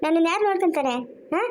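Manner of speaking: ordinary talk, nothing marked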